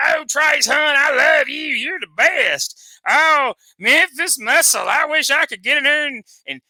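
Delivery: high, loud, fawning shouts, slow; mock gushing fan. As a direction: swoon over the wrestlers like a starstruck fan. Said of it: "sit over there on the microphone all night and be".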